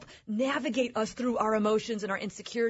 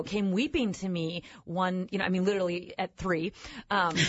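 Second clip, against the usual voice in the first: ordinary talk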